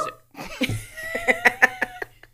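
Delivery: big high whooping laugh; delighted shrieking laugh; loud burst of laughter. Real, not acted. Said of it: "she gets very excited".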